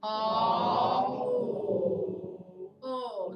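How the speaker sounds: Aw held loud, then a narrower O; teacher showing the lip rounding. Shown as a demonstration. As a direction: glide from aw into O. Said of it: "So aw to O".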